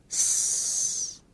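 a sustained, voiceless "s"; drawn-out s. Shown as a demonstration. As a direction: hold "s" as a long hissing sound. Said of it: "các em kéo dài âm".

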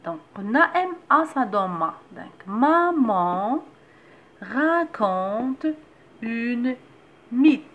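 loud, slow and clear, word by word; slow sentence reading. Shown as a demonstration. Teacher reading a simple sentence aloud for mothers, slow and loud. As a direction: read it out slowly for the little one. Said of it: "باش نتعلمو نقراو حتى اون فراز".

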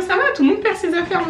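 high, hurried mock reassurance; imitated dismissive listener. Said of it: "Vous allez me dire".

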